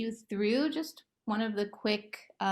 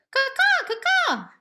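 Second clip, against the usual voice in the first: loud, very high cawing; crow caws; 9 dB louder than usual. Shown as a demonstration. Therapist showing kids a bird-like monster, loud high caws. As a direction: caw like a crow.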